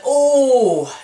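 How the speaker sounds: loud, high taunting oh; the whole gang going ooh at a burn; mock crowd oh. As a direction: friends jeering as Cameron gets Slurpee on his shirt. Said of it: "His friends were like".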